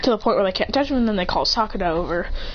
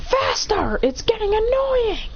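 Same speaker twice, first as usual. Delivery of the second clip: high, drawn-out whine of impatience; annoyed demand; 12 semitones higher, slower than usual. Acted. Madara hurrying Naruto along, annoyed demand. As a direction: nag at a high pitch, stretching the end.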